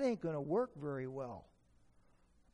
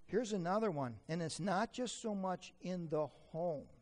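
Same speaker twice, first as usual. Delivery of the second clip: ordinary talk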